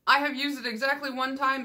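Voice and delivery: normal talking voice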